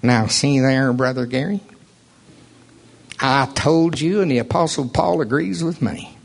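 nasal mocking preacher voice; whiny nasal impersonation of a preacher; nasal creature voice. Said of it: "in [name]'s best nasalated creature voice".

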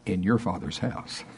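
softly savoured, slowing down; tender aside. Shown as a demonstration. Preacher to congregation, lovingly soft. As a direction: lean in and savour the phrase. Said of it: "I like to say".

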